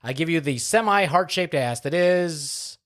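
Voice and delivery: big announcer voice, pitched high; booming podcast host introduction; hype show intro. Acted. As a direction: roll out the grand welcome like a showman.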